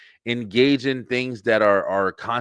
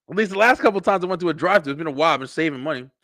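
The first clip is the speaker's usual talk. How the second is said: usual speaking voice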